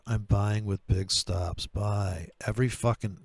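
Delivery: usual speaking voice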